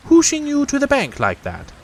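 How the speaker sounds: high, self-pleased rabbit voice. A narrator's reading.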